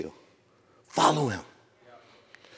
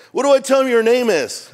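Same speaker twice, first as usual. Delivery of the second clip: higher, louder Moses asking; pitched-up mock question; 9 semitones higher, 4 dB louder than usual. Moses pushes God for his name, pitched up. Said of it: "God does not have an identity crisis".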